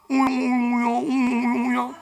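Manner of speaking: humming whirr of a spinning sling; sling whirling noise. Retold or imitated. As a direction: hum like a sling being swung. (물매 돌리는 소리 흉내)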